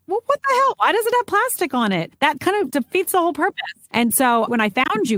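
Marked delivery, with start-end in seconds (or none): 0.1-3.6 much higher, incredulous outburst; indignant surprise. Real, not acted. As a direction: let the disbelief spike. Outrage at plastic tampons.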